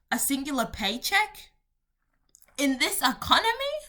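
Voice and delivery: high, loud rising disbelief; incredulous outrage. Real, not acted. Ranting about a five hundred dollar hobby, incredulous. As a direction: question it as if scandalised.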